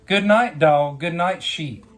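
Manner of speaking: usual speaking voice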